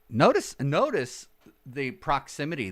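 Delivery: ordinary delivery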